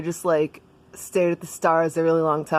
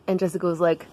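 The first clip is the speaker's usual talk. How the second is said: plain reading voice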